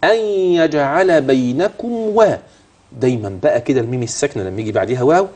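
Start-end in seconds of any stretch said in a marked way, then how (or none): 0.0-2.4 أن يجعل slow, nun of أن nasalized into يجعل; غنة في أن يجعل; ghunna on أن. Shown as a demonstration. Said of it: "عند وصل أن بيجعل".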